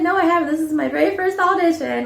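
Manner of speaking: high, chirpy audition answer; cheesy eagerness. Retold or imitated. Answering casting at a first audition, cheesy and eager. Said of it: "I was just so cheesy".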